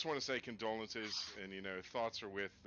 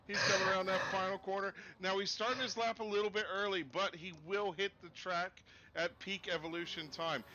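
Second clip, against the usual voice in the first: lively higher play-by-play call; race commentary; 11 semitones higher than usual. Acted. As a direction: call the lap live, pumped and upbeat.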